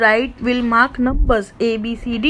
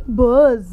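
bee buzz. Shown as a demonstration. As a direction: buzz with a long held z.

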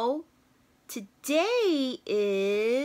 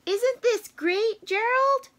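much higher, eager Piggie; excited Piggie; 11 semitones higher, 4 dB louder than usual. Acted. Piggie asks Gerald, high and eager. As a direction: ask it bright and thrilled.

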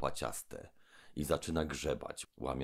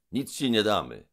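loud, high defiance; Trull shouting; 7 semitones higher, 8 dB louder than usual. Acted. Trull shouts refusal at the robber. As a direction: refuse him at full voice. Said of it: "zawołał".

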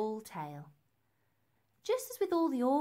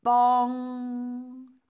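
loud, high bong with a long ringing hum; sung-out bell strike, held on the ng; ringing bell imitation; 4 semitones higher, 9 dB louder than usual. A narrator's reading. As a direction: strike it hard and let it ring.

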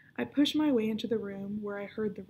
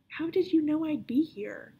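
Violet demands in a higher, louder whisper; demanding; 7 semitones higher, 6 dB louder than usual. A narrator's reading. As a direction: press him hard for an answer. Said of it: "Violet demands".

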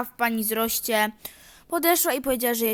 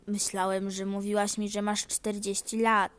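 ordinary talk

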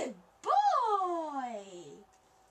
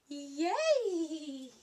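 ordinary talk

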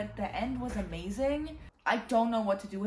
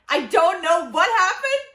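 much higher, much louder exclaim; excited outburst; 8 semitones higher, 14 dB louder than usual. Real, not acted. Reader exclaiming to viewers about plot twists, excited burst. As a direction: burst out with the shock of it.

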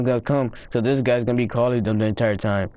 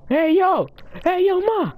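high call across the map, much higher; slower than usual. Real, not acted. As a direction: hail a far-off teammate. Calling out to teammate.

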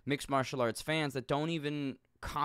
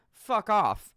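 emphatic outburst; 8 semitones higher, 9 dB louder than usual. Real, not acted. Dismissing critics emphatically.

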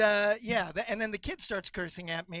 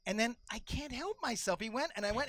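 normal talking voice

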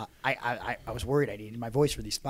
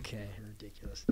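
whisper; 4 semitones lower, 14 dB quieter than usual. Real, not acted. Quiet aside after smart mark.

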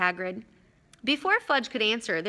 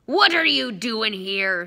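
loud, slow furious demand; big angry bellow, drawn out; 6 dB louder, slower than usual. Acted. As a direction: growl it out at an intruder. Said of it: "said Hagrid furiously".

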